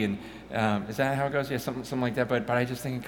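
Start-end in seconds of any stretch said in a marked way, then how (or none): none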